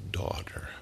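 whispered softly, gentle and loving; tender whisper. Retold or imitated. Jesus tenderly addressing the healed woman, whispered. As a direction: breathe the word like a blessing. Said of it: "it was a tender word".

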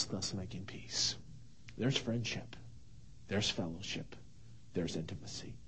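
breathy whispered phrase, dropping to nothing. Real, not acted. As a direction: let the words fade into a whisper. Whispered close of scripture line.

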